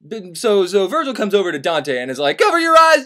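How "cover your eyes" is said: high, loud cry to cover up; high urgent shout; urgent warning. Acted. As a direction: yell it fast before Medusa looks. Virgil warning Dante of Medusa.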